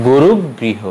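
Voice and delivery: গুরুগৃহ held on middle g; গুরুগৃহ with a stop before "griho"; doubled g. Shown as a demonstration. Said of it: "হবে".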